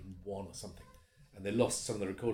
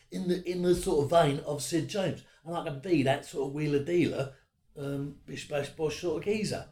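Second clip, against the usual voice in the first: put-on Cockney wide boy; 4 semitones higher, 4 dB louder than usual. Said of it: "like a Cockney wide boy".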